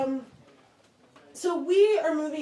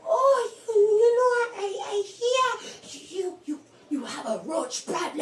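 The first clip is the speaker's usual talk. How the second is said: stammering uncle; 7 semitones higher than usual. Retold or imitated. Uncle asking about roaches, high and stammering. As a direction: play an excitable uncle tripping into his question.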